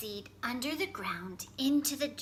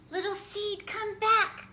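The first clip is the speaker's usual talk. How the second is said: high, loud call for a lost friend; little fox crying out as the farmer takes his friend away; 10 semitones higher, 6 dB louder than usual. A narrator's reading. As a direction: call after him, much higher and louder. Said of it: "cried the little fox".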